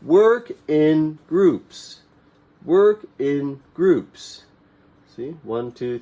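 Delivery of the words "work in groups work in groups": "Work in groups" slow and loud, stress on work; slow choral drill. Shown as a demonstration. Teacher leading class repetition, slow and loud. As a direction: lead the class to repeat it slowly. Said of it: "say it with me".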